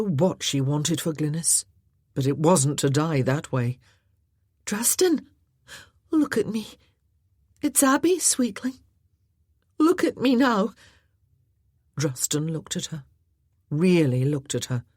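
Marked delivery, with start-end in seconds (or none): 4.6-10.7 gentled voice. Acted. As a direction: coax him gently to look at you. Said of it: "tried to gentle her voice".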